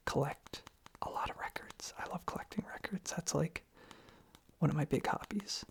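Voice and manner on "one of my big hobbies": normal talking voice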